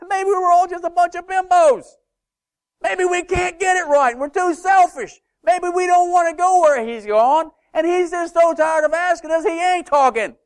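high, loud preaching build; preaching at full force. Real, not acted. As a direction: let it ring out to the back pews. Preaching climax.

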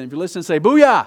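loud booyah shout to the room; rally them with a loud booyah; calling out. Real, not acted. Preacher rousing the congregation, loud call.

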